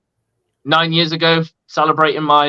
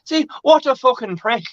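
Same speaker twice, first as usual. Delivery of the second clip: higher, sweary Irish accent; 9 semitones higher than usual. Shown as a demonstration. Guest curses the one running the show, Irish accent. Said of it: "I could do Irish as well".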